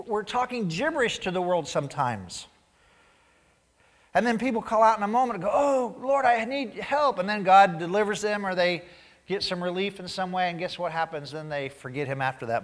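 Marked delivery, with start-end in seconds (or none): 5.5-7.2 mock desperate plea. Person crying out in crisis.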